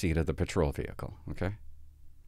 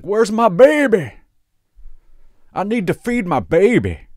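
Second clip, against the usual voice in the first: high, loud, slow wailing mock drunk; hammed-up drunk mother, much higher and louder; drunk mom; 12 semitones higher, 12 dB louder than usual. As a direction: wail like a sloppy drunk mother. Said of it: "And she's like".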